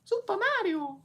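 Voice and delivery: very high cartoon falsetto; Mario. Streamer doing a game impression, high Mario voice. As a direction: squeak it out like a game character. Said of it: "Also, I can do a really good".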